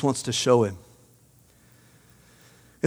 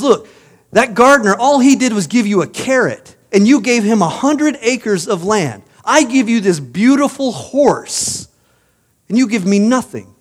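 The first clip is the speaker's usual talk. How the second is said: higher, indignant outburst; angry nobleman; 6 semitones higher, 3 dB louder than usual. Acted. Nobleman protests to the prince, higher and indignant. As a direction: complain bitterly that you were cheated. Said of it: "the nobleman is angry, furious".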